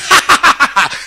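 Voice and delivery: imitating a rich man's smug laugh. Preacher imitating how a rich man laughs, smug laugh. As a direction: put on a rich man's satisfied chuckle. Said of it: "Tu connais comment il rit".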